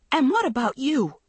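high, loud angry retort; furious cry. A narrator's reading. Andrea turns the blame on her friend, furious. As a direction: fling the question back furiously. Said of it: "Andrea cried furiously".

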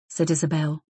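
plain reading voice

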